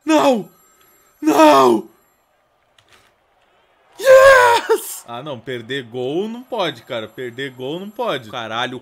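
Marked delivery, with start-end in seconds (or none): 0.1-1.9 high, loud anguished cry; loud despairing no; desperate no. Real, not acted. About to miss the goal. 4.0-5.0 very high, loud victory shout; triumphant yes. Real, not acted. Catches the goal in time.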